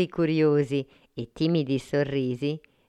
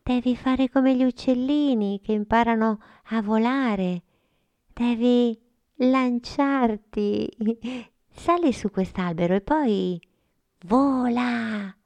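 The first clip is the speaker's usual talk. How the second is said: put-on higher girl's voice, kind and coaxing; higher girl voice encouraging Frullina; encouraging little girl; 8 semitones higher than usual. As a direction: gently urge a friend to try.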